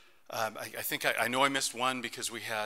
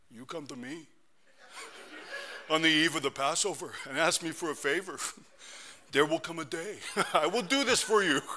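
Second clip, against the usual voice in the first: Pilate with a hammed-up Italian accent, laughing through it; mock Italian mobster voice, higher and louder; 5 semitones higher, 4 dB louder than usual. Preacher imagining Pilate as an Italian, hammy Italian accent. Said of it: "he's this Italian".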